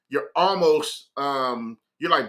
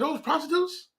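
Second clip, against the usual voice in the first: high, softer incredulous question; disbelieving; 12 semitones higher, 5 dB quieter than usual. Retold or imitated. Asking Dave about the women on his app, incredulous. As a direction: question it like you can't believe it. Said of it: "So I can't believe it".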